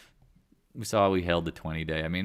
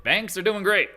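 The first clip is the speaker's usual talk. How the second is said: much higher, louder mock cheer; sarcastic; 12 semitones higher, 10 dB louder than usual. Realtor mocking banks to viewers, sarcastic. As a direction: fake enthusiasm, then drop it. Sarcastic claim then denial.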